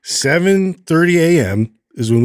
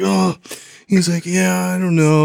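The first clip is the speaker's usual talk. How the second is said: put-on higher, relieved boy; calmer kid voice; 6 semitones higher than usual. Son thanking dad over ice cream, relieved kid.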